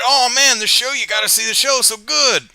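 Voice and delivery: high, loud, fast gushing; gushing fan. Retold or imitated. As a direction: rave like an overexcited fan.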